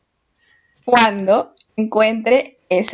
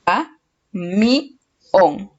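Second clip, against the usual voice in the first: "A, mi, on" split up, landing firmly on "on"; syllables, finding the stress; much slower than usual. Shown as a demonstration. Teacher testing which syllable is strongest, syllable by syllable with weight on "on". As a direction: pause between "A", "mi" and a weighted "on". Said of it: "la sílaba que tiene la mayor fuerza de voz".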